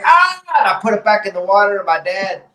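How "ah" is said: very high mock scream of pain; cartoon pain yelp. Retelling the hand leaving the water, mock agony. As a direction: jump up into a comic burnt-hand yelp. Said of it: "when I took it out, I was like".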